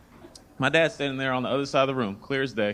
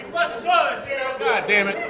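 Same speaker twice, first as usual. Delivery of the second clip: high, loud, exasperated scolding; host angrily scolding guest Cheryl in a high, loud voice; mad host; 7 semitones higher, 3 dB louder than usual. Retold or imitated. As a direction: scold the guest in a much higher, louder voice. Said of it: "Then they get mad at you".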